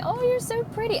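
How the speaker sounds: put-on high, sugary child's compliment; sweet kid voice. Child flattering the teacher, mock sweet high voice. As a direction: coo it up like a little kid buttering you up. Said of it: "and the kids say".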